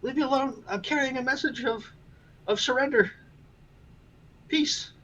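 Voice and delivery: very high squeaky mouse voice; little mouse piping up in protest; yelling mouse. Acted. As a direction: plead in a tiny, high-pitched squeal. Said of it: "the mouse is gonna yell back".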